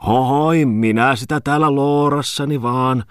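lightly voiced Häme dialect, calling out. Tommola calls from the other room in Häme dialect. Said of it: "hämäläistä murretta korostaen".

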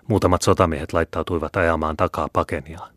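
ordinary delivery

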